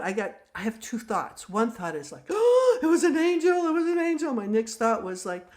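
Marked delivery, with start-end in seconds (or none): 2.3-4.3 gasping, high and loud excitement; acted-out breathless delight; thrilled. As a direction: squeal it in giddy awe. Retold first thrilled thought.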